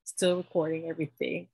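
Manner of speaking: normal talking voice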